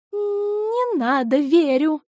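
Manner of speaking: high, slow flustered plea. Acted. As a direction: protest in a rush of nerves. Взволнованная жена поспешно отказывается. (взволнованно)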